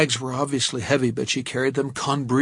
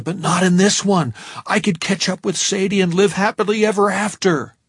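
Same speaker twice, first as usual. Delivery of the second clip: excited; 7 semitones higher, 7 dB louder than usual. A narrator's reading. Narrator's sudden relief and elation.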